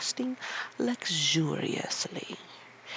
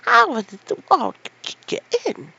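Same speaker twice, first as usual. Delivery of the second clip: much higher, loud burst of curiosity; curious old man; 12 semitones higher, 9 dB louder than usual. Acted. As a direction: blurt it out despite himself. Ben overpowered by curiosity.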